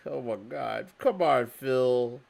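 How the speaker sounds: normal talking voice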